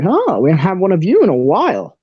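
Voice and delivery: big swooping pitch on the remark; mildly surprised, with sing-song rises and falls; intrigued ticketmaster. Acted. Ticketmaster seeing a rare warlock, intrigued swooping voice. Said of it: "the Ticketmaster looks at him".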